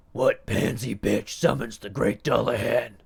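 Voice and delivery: loud gruff demon voice; deep gruff voice. A narrator's reading. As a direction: boom it like a monstrous headless knight. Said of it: "in a deep and gruff voice".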